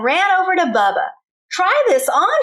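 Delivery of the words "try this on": high, eager call; eager cry. Acted. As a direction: urge him to try it on. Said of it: "she cried".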